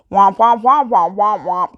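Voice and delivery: muffled womp womp trombone mimic; Charlie Brown adult voice. Mocking the surgeon's jargon as Charlie Brown womp womp. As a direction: imitate the Charlie Brown teacher droning. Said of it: "Charlie Brown".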